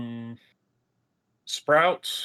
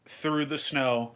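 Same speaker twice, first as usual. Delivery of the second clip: ordinary talk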